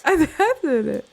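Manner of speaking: high, slow dorky voice; mock yourself as a drawling nerd; nerdy self-parody. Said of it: "nerdier somehow".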